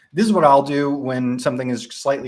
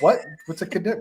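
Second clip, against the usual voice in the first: baffled question; 8 semitones higher, faster than usual. Retold or imitated. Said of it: "And you're like".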